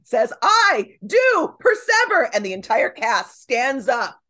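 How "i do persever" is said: high, slow theatrical proclamation; grandly re-enacted film dialogue, pitched high; declaimed stage line. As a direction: draw out each word like a triumphant Shakespearean line.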